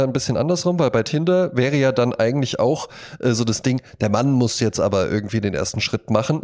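ordinary delivery

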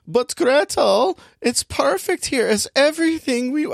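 very high boy's voice with a mock German accent. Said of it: "I don't know why I'm making him German".

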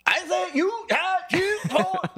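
high, drawn-out shouted words; strained high yell for comic effect; mock crowd shout. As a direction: holler it slowly and high. Acted shout over a crowd.

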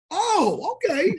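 high, loud mock realization; sarcastic surprise. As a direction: react with exaggerated, offended surprise. Acted sarcastic reaction to overheard remark.

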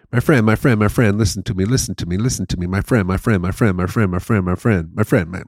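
put-on insistent Persian-accented hawker patter; relentless looping sales pitch in a Persian accent; Persian street seller. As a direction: rattle it off as a pushy Persian vendor. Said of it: "friend as Persian".

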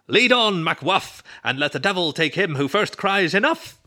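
high, loud theatrical declamation; mock-heroic declaiming. A narrator's reading. Roommate leads the friends off to lunch, mock-heroic. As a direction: ham it up like stage Shakespeare.

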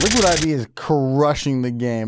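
usual speaking voice

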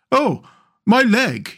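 high-pitched cry of pain. A narrator's reading. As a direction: cry out in sudden hurt. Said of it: "he cried".

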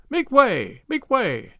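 high-pitched urgent call; crying out. A narrator's reading. Fleeing crowd clearing a path, calling out high. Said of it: "cried the voices".

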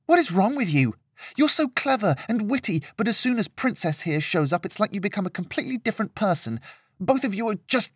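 high-pitched, exasperated tirade; irate. A narrator's reading. Girl turning her ire on her friend, high exasperation. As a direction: rant accusingly, too worked up to finish. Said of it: "Lillian turned her ire towards her friend".

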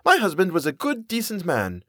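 high-pitched affronted defense; indignant. A narrator's reading. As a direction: defend him as an offended mother. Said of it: "Mother snapped".

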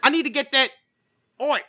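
loud, high, hammed-up Australian voice; mock Aussie shout, half Cockney; bad Australian accent. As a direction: belt out a botched Australian accent that slips into Cockney. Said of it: "he's, he's Australian".

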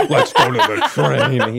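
laughing imitation of Rick Grimes; Rick Grimes impression. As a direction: drag it out in a hammed-up Rick voice. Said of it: "He's like".

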